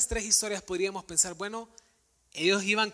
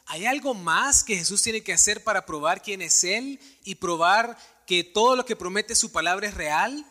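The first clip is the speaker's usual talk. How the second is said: louder, rising preacher's appeal; full-force preaching; 3 semitones higher, 5 dB louder than usual. Acted. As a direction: drive the list home to the congregation. Predicación con fuerza creciente. (predicación enérgica y en voz alta)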